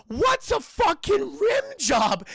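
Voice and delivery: very high, loud, drawn-out question; mock outraged parent. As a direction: shout it slowly in a put-on parent voice. Acted parent's shocked question.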